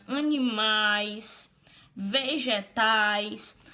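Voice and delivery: "animais" and "vegetais" drawn out, slowly; slow demo words. Shown as a demonstration. Teacher showing vowel clusters to Denis, slow and drawn out. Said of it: "todas essas palavras aí unem vogais".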